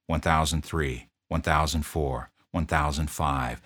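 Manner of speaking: ordinary delivery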